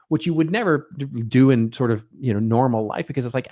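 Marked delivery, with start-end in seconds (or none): none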